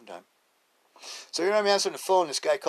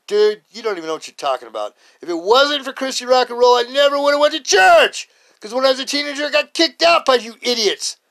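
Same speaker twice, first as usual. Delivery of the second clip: higher and louder, heated retort; raised voice; 11 semitones higher, 11 dB louder than usual. Retold or imitated. Arguing on air with the anti-rock host, raised voice. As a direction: push back hard and loud. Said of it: "But I raised my voice".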